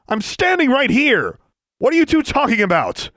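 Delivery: loud, high-pitched outburst; exasperated shout. A narrator's reading. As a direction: demand to be noticed and told. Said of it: "Alexandra shouted".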